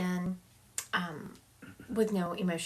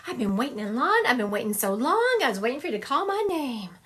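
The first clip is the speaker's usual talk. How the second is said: hammed-up, higher-pitched imitated complaint; a little dramatic; 4 semitones higher, 5 dB louder than usual. Mama Betty stepping up after waiting in line, dramatic. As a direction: play up the long-suffering wait. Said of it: "she's being a little bit dramatic".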